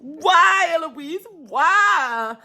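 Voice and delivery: high, loud dramatic lament; exasperated wail. Real, not acted. Reader laments the characters to Eloise, exasperated wail. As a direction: wail the whys in despair.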